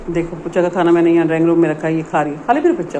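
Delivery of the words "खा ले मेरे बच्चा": higher, sweet coaxing to a pet; talking to the dog while it eats, fond coaxing voice. Real, not acted.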